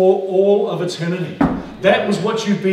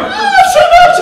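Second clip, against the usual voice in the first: much higher, loud, awestruck cry; overwhelmed creatures; 10 dB louder than usual. Acted. Preacher acting the living creatures seeing God's glory, squealing high. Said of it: "They go".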